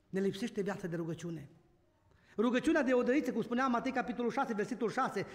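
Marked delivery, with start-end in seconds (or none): 0.1-1.5 lower, softer echo of "Ne lipsește viața de rugăciune"; quieter repeat. Real, not acted. Scene: preacher repeats his lament about prayer to the church, softer. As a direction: let the repeat sink in quietly.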